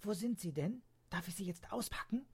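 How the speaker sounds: high, then whispered eager questions; a child who can't wait to unwrap; eager little girl. Acted.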